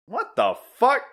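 high, loud baffled yelling; shocked outburst. Retold or imitated. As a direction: yell it totally bewildered. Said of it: "he's like".

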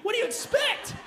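mock shouted God reply; exasperated shout. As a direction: throw it back, exasperated. God's mock reply continued.

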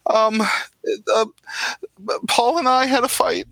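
high, stammering, near tears; frightened woman begging for help; shaking voice. Acted. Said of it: "why are you shaking".

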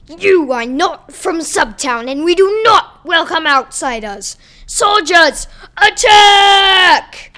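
building from firm order to full shout; commanding shout. Acted. Captain orders attack.

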